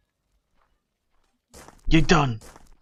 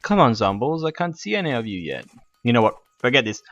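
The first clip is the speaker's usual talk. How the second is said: normal talking voice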